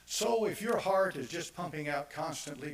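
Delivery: ordinary delivery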